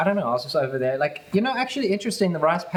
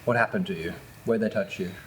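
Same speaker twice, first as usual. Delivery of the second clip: put-on gather the kids gently, lower; storyteller to children; 6 semitones lower than usual. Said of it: "Story time, children".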